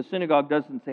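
ordinary talk

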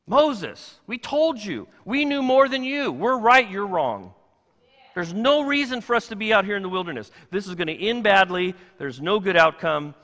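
high, loud know-it-all reproach; blaming Israelites. Retold or imitated. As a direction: rub it in that they were right all along. Israelites blaming Moses, acted quarrel.

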